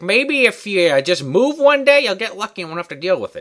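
high, loud goofy advice voice; mock teacher advice. Host mocks teacher's useless bully advice, high and goofy.

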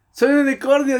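high, loud imitation of a proclamation; mock shout. Friend doing the dare in the restaurant, loud mock shout.

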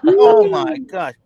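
high, slow, drawn-out oh my God; disbelief. Real, not acted. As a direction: stretch it out in disbelief. Reacting to mac-cheese ice cream.

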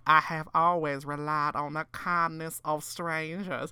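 slow, hammed-up Southern drawl; joking Southern accent. Retelling a trophy winner's joke, mock Southern accent. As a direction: play at being a Southern belle. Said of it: "like, joking in that accent".

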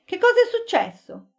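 sudden high alarmed question; jolted awake, asking what happened. A narrator's reading. Waking in alarm.

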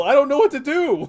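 high, loud, frantic plea; panicked yell. Retold or imitated. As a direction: cry out in confusion. Panicking driver under guns.

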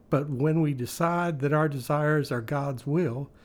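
normal talking voice

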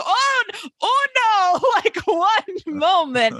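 high-pitched put-on voice, breaking into a laugh; flustered imitation. Acts out pleading with train conductor.

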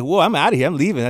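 high, mock-panicked exit; alarmed. Boyfriend fleeing his girlfriend's threat, high and mock-panicked. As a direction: bolt in mock fear.

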